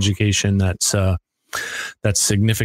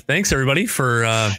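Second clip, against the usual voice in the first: ordinary delivery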